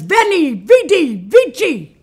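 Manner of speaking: booming, high Latin proclamation; Julius Caesar. Acted. Caesar announces himself to the steward, loudly proclaiming. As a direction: declaim each word like a triumphant general. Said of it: "You talk funny".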